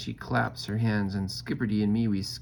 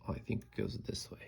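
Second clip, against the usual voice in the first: whispering; 11 dB quieter, faster than usual. Real, not acted. Aside while turning pages.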